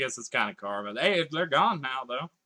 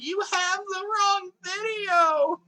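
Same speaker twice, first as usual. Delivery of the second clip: very high, slow squeaky meme shout; 3 dB louder, much slower than usual. Retold or imitated. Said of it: "I'll just D M the person, like".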